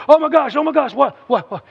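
high, loud hammed-up panic; exaggerated flustered alarm; mock freak-out. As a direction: play at freaking out, pitch jumping up. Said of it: "Jesus wasn't freaking out going".

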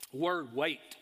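ordinary talk

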